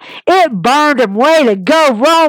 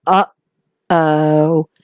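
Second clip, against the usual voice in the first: imitated commentators' uh-oh; 9 semitones lower than usual. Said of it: "hear Pat McAfee and, and Michael Cole go".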